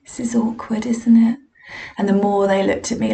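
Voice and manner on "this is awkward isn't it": mock sympathy. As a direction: imitate hushed, embarrassed pity. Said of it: "with that kind of sympathetic smile thing".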